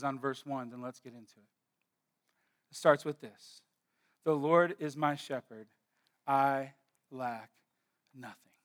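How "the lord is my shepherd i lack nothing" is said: soft, reverent reading. Acted. Reads the verse softly, slowly.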